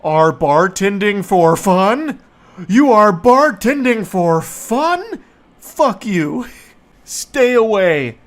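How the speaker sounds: loud, slow, incredulous rant; mock outrage. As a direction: hammer out each word in exaggerated indignation. Reads angry coworker's messages aloud.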